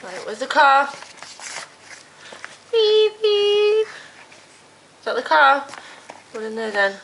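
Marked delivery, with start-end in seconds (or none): 2.7-3.9 playful toy car beeping; car horn beeps. Pretend. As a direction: beep like a toy car for a toddler. Dad makes toy car noise.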